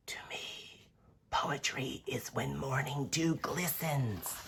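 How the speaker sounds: soft, hushed, far-off little spider voice; whispery spider. Acted. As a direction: breathe it out like a tiny spider. Spider character voice.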